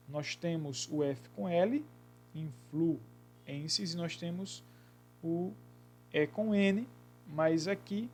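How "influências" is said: slow demo word. Shown as a demonstration. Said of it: "Na palavra influências".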